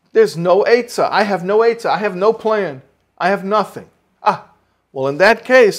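urgent, rousing storyteller-preacher; impassioned preaching. Acted. Preacher voices son's explanation to father.

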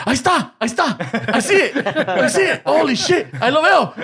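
put-on dad sounding like an excited kid; like a little kid. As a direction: point and squeal like a child spotting something. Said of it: "like, a little kid".

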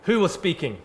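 sharp, loud call for silence; a hint of a fed-up teacher silencing the class; stern teacher. Teacher Missus Bow silencing a rowdy class, loud and stern. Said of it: "so she said".

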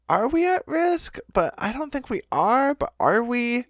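high, dithering worried voice; nervous librarian. Retold or imitated. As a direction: fret back and forth anxiously. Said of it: "librarians were like".

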